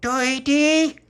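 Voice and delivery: put-on loud, very high baby squeal; insistent toddler. Baby Lucia calls Tony insistently.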